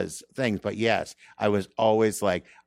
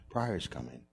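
hushed whisper; 4 semitones lower, 9 dB quieter than usual. Retold or imitated. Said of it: "Why are we whispering?".

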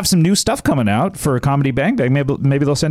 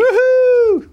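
high whooping cheer; excited cheer; 3 dB louder than usual. Real, not acted. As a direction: cheer loud and high for the win. Cheering the winner.

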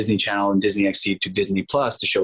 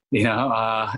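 ordinary talk

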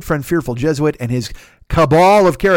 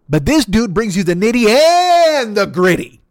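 hype announcer; 7 semitones higher, 5 dB louder, slower than usual. Acted. Hyping the sponsor's show.

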